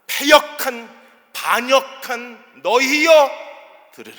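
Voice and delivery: slow, loud, high furious cry; angry outburst. Acted. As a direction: hurl it at the rebels in rage. Moses bursts out in anger. (분노에 찬 외침)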